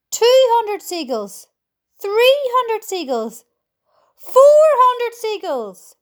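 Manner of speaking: high, slow, building shouts; shouting. A narrator's reading. James counting captured seagulls, shouting.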